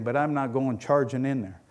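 ordinary talk, nothing marked

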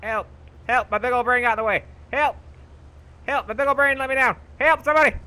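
high, loud hammed-up yelling for help; mock cries for help. Gamer stuck in the game, hammed-up calls for help. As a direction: yell for rescue with comic despair.

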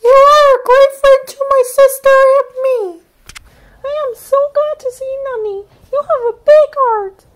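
very high small voice; a grateful sister; high squeaky thanks; sweet sister Pai Hai. A narrator's reading. Lani speaks.

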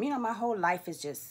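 normal talking voice

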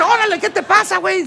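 reclamo imitado, muy agudo de golpe; reclamo indignado. Retold or imitated. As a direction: protesta exagerada de indignación. (indignado, reclamando)

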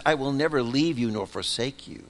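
normal talking voice

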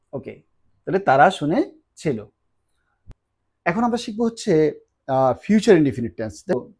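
ordinary delivery

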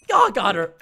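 ordinary delivery

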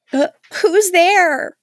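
high, slow, timid who's there; mock scared girl. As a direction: play the dumb scared victim, drawn out. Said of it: "she, like".

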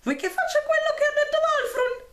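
very high squeaky mimicry; shrill mocking voice. A narrator's reading. Caspar mocking the bickering boys, squeaky high voice. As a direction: keep up the shrill squeaky mockery. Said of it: "modulò una vocetta stridula".

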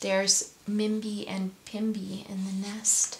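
soft, hushed whisper; whispered aside. Real, not acted. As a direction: whisper it as an aside over the picture. Hushed aside about the picture.

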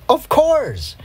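very high, exclaimed "of course"; emphatic exclamation. A narrator's reading. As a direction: pipe it up high, utterly sure. Girl answers her own questions gleefully.

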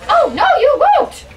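high, loud squeal of defiance; high squeaky fairy voice. Pretend. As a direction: squeak it out, cheeky and shrill. Cupcake fairy defies the mouse.

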